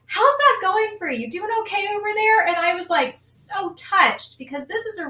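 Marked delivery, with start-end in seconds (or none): 0.0-2.5 cheery instructor check-in. Retold or imitated. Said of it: "she's like".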